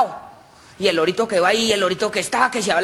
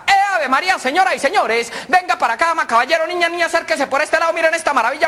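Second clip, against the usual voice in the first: high, rolling market pitch; paisa hawker; 7 semitones higher than usual. Acted. Paisa sells the skinny cow to the crowd, hawking. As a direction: hawk it to a crowd like an auctioneer. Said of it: "Cuando empieza el paisa".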